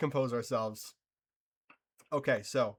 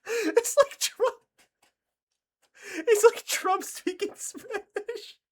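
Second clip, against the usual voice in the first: high, slow words wheezed through tears of laughter; real helpless laughter, high and slow; crying with laughter; 4 dB louder than usual. Real, not acted. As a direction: laugh until it hurts. Laughing at the show.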